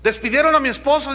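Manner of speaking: hammed-up anxious mother; desperate widow. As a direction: beg the pastor for an answer. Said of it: "dice otra".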